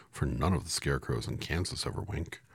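ordinary delivery, nothing marked

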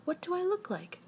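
high-pitched question called down; mild shout. A narrator's reading. Pooh calls down from the balloon, mild high shout. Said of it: "shouted Winnie the Pooh down to you".

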